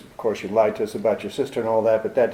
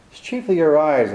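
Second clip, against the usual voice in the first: ordinary delivery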